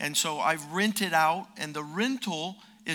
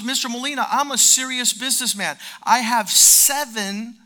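boastful businessman; 6 semitones higher, 11 dB louder than usual. Retold or imitated. Tenant boasting to the preacher.

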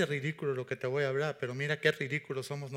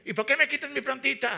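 high, loud aggrieved complaint; whining Jonah; 10 semitones higher, 6 dB louder than usual. Acted. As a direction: protest like a sulking prophet. (Jonás quejándose fuerte y agudo)

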